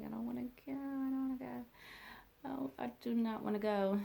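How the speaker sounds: imitated pleading, pitched well up; reluctant whine. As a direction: whine it like an unwilling kid. Retold pleading with aunt.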